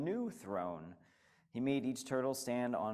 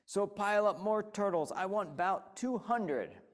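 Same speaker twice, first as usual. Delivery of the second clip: higher, louder bellow; 7 semitones higher, 4 dB louder than usual. Acted. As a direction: bellow the order down. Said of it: "he bellowed and brayed".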